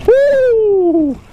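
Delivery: high joyful whoop; excited whoop. Real, not acted. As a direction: cheer at the big fish. Big fish at the net.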